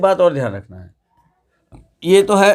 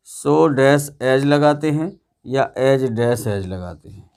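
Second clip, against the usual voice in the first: slow dictation; 2 semitones lower, slower than usual. Shown as a demonstration. Teacher dictating the pattern slowly.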